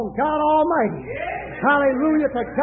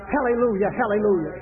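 ordinary talk

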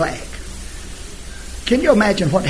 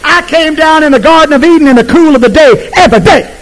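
high, loud preacher's cry; booming pulpit voice; full-force preaching; 9 semitones higher, 8 dB louder than usual. Acted. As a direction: preach it out over the crowd.

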